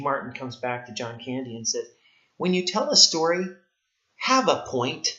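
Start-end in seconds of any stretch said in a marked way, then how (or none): 2.4-5.1 imitating a blunt put-down, higher and louder; brutal retort. Steve Martin telling off John Candy for pointless stories, brutally blunt. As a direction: quote it as a pointed, not-nice rebuke. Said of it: "it's pretty brutal. I mean, he's not nice about it".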